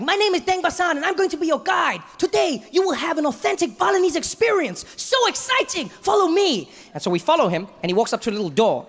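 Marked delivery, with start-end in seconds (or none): 0.0-6.7 cheery guide. Retold or imitated. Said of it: "he's like".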